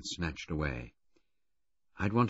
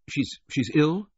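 higher, slow and stammering with worry; anxious stammered question, pitched up; 10 semitones higher, 4 dB louder, slower than usual. A narrator's reading. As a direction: ask it haltingly, dreading the answer. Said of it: "I said with anxiety".

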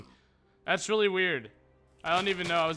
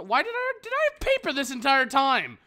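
high, loud shocked yelp; startled outburst; 10 semitones higher, 9 dB louder than usual. Real, not acted. Player discovering paper, surprised high yelp. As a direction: suddenly realise you had it all along.

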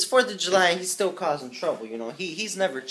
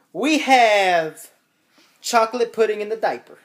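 mock-dramatic announcement; 7 semitones higher, 9 dB louder than usual. Real, not acted. Announcing the mess.

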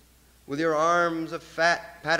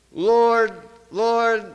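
higher, louder call to the Lord; crying out Lord; 4 semitones higher, 5 dB louder than usual. Acted. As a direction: lift the voice up to heaven. Rising invocation in the poem.

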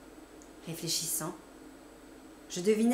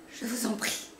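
pleading; 3 semitones higher than usual. A narrator's reading. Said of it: "cri de souffrance".

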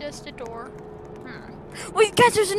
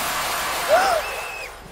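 scared gasp; 11 semitones higher than usual. Real, not acted. Fright in dark room.

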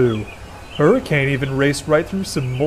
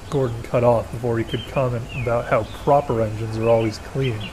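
ordinary delivery

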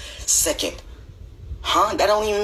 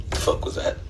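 whispered in disbelief; stunned whisper; 4 dB quieter than usual. Acted. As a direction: breathe it out, shocked. Shocked reaction.

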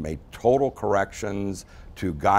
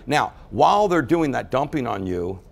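ordinary delivery

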